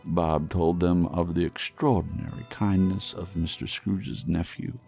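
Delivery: plain reading voice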